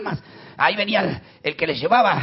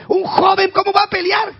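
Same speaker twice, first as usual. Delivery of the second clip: much higher, loud despairing cries; panicked soldiers; 12 semitones higher, 6 dB louder than usual. Retold or imitated. Israel's soldiers despairing over David, alarmed shouting. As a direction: shout in alarm that all is lost. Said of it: "Muchos de ellos decían".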